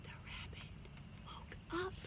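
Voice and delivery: very soft near-whisper reveal; hushed. Acted. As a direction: drop to a hush. Hushed reveal after gasp.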